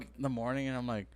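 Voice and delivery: ordinary delivery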